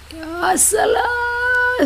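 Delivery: high, very slow crying wail; mourning a lost child, sobbing wail. Retold or imitated. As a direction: wail like a parent over a dead child. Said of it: "seperti menangisi anak yang telah mati".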